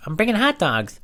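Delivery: put-on high, squeaky twelve-year-old boy; kid voice John. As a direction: play a pesky little kid on the phone. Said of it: "call up as this guy, John".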